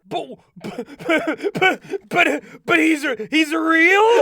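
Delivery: stammering, loud and slow at the end; shocked disbelief. Acted. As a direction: sputter it out in shock. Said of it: "And that's what Bubble Bass says".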